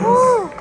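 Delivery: high excited woo; cheer. Real, not acted. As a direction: whoop with joy. Cheer after record.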